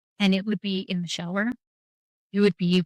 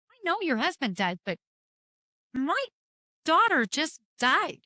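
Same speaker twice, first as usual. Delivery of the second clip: retold exasperated retort; 7 semitones higher than usual. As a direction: insist in a raised pitch, slowing at the end.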